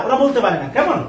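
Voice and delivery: normal talking voice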